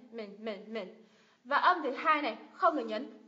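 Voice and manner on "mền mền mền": "mền" said softly with light stress. Shown as a demonstration. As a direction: ease off on each "mền". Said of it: "nhưng nhấn nhẹ hơn này".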